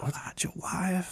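slow, high whisper of the warning; whispered meme quote. Retold or imitated. Joking with friends about Jackson, whispered meme warning. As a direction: breathe it out like a secret alarm.

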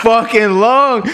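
high, drawn-out exasperation; mock angry. Friend scolding the band for the long wait, mock anger. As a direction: stretch it out high in playful outrage. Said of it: "so fucking angry at you".

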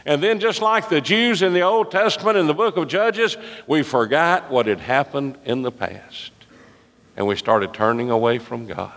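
fiery preacher rising with conviction; preaching at full force. Real, not acted. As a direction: preach it up to a climax.

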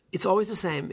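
ordinary talk, nothing marked

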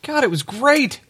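high, gushing praise; overly gushing. Pretend. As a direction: gush about it way too much. Gushing over the show he ran.

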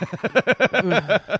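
bursting into loud laughter; boasting then laughing hard at yourself. Real, not acted. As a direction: crack up at your own boast.

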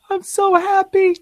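high, slow put-on happy voice; mock happy. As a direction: sigh it out in a fake chipper voice. Sarcastic sigh, very high far voice.

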